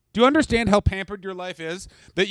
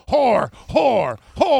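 mock father; 5 semitones higher, much slower than usual. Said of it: "saying things like".

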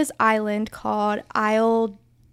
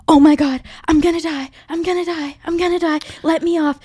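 panicked; 6 semitones higher, 4 dB louder than usual. Retold or imitated. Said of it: "she was like".

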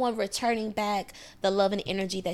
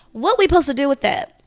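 loud, indignant question; exasperated loud question; 4 semitones higher, 10 dB louder than usual. Real, not acted. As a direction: demand it, baffled and annoyed. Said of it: "exasperated rhetorical question".

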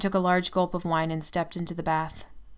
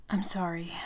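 breathed apology. Acted. Tim whispering an apology to his tearful girlfriend. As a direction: barely breathe it out. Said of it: "he breathed".

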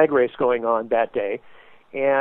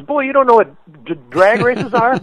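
teasing driver; 6 semitones higher, 4 dB louder than usual. Retold or imitated.

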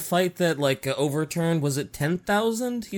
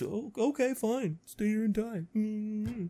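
soft, higher-pitched mock sulk; petulant resignation; 4 semitones higher, 9 dB quieter, slower than usual. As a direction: give in like a spurned leader, quietly. Mocking the rejected emperor's exit.

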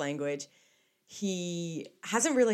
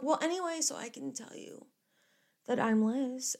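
high, soft retold self; sheepish moving on; 7 semitones higher, 5 dB quieter than usual. Granddaughter changing subject with grandpa, high sheepish voice. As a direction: brush past it in a small high voice.

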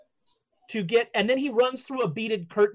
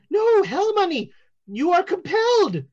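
higher, louder command; insistent seer; 8 semitones higher, 4 dB louder than usual. Acted. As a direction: insist they must obey. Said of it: "He's like".

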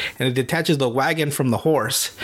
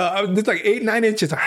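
ordinary delivery

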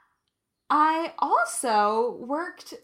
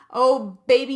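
sassy exclamation; 11 dB louder than usual. Real, not acted. Sassy aside to viewers, loud and teasing. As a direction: belt it out like a tease.

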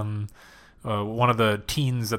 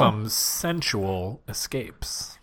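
slow tagline read; 3 semitones lower, 7 dB quieter, much slower than usual. Acted. Jokingly reading tagline slowly.

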